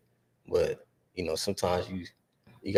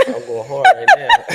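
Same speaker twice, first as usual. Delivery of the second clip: laughing; 13 dB louder than usual. Real, not acted. Joking about age, laughing.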